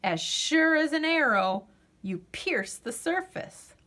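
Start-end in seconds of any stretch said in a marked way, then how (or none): none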